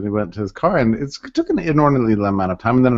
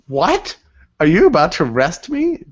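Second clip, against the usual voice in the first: surprised; 6 semitones higher than usual. Retold or imitated. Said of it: "I'm like".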